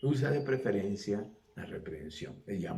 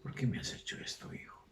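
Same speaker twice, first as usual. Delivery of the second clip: hushed, softer, half-whispered plea; tender tearful reproach; 3 semitones lower, 6 dB quieter than usual. Retold or imitated. Mary gently reproaching the boy Jesus, in a soft whisper. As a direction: scold gently, almost in a whisper. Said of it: "es posible que María haya llorado".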